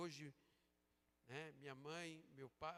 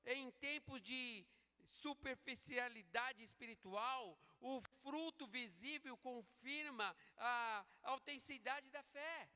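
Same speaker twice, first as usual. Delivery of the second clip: loud, higher, slow pulpit delivery; full-force preaching; 7 semitones higher, 6 dB louder, slower than usual. Real, not acted. Preacher challenging the congregation on true followers of Christ, forceful preaching. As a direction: preach it out slowly, in a raised voice.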